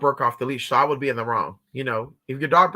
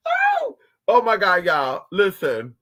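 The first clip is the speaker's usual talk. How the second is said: ordinary talk, nothing marked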